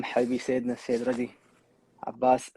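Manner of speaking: normal talking voice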